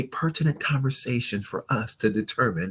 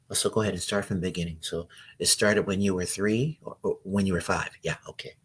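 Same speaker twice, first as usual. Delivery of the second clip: resigned counselor; 4 semitones lower, 7 dB quieter, faster than usual. Acted. Acting counselor taking notes on date.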